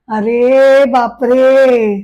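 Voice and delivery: long drawn-out, appalled cry; aghast exclamation. Acted. Father shocked at what his daughter did, drawn-out aghast exclamation. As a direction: stretch out each word in dismay.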